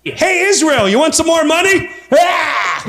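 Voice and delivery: high-pitched, overexcited call; hammed-up generous donor; mock eager offer. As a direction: call it out high, ending in a silly yell. Acts eager donor to Israel.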